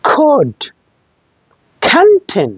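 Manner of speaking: could and canteen high, C like খ; aspirated K. Shown as a demonstration. Teacher drilling C words, aspirated could, canteen. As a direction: puff hard into could and canteen. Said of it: "খর মতো উচ্চারণ হবে".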